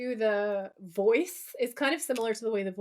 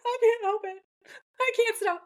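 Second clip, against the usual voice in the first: high-pitched helpless mock protest; out of control; 11 semitones higher, 3 dB louder than usual. Imitating Dean on truth serum, helplessly out of control. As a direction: squeal it as if unable to stop yourself. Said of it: "Dean is just out of control".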